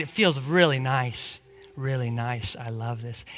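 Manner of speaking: low, soft, savoring murmur; cozy contentment. Pretend. Preacher pretending to warm himself by fireplace, cozy contentment. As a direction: sink softly into the warmth. Acting cozy warming by the fire.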